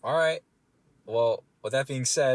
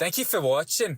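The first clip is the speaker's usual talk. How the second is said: raised, jokey Scottish sign-off; playful Scottish brogue; Scottish accent; 5 semitones higher, 4 dB louder than usual. Pretend. Jokey sign-off amid Scottish bit.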